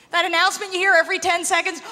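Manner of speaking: normal talking voice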